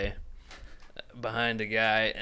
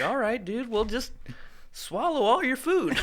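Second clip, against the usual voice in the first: much higher, laughing through it; comedian impression; 11 semitones higher than usual. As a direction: ham up the comedian's line, laughing. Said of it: "I can't do it as good as him".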